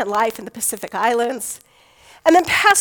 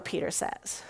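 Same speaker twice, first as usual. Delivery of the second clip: ordinary talk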